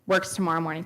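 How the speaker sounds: ordinary talk, nothing marked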